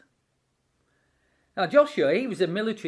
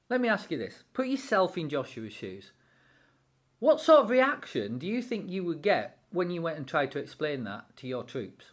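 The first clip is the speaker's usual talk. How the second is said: usual speaking voice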